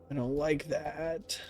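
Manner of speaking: hushed, slow and uneasy; scared murmur. Real, not acted. Scared whisper in horror game.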